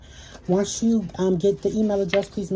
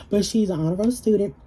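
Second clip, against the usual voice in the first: normal talking voice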